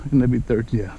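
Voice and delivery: normal talking voice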